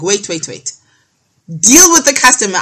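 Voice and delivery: a loud retold shout, high and brushing her off; impatient shout. Supplier on the phone turns to his shop customer, impatient loud shout. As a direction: bark it like a busy shopkeeper cutting you off. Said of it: "he'd be like".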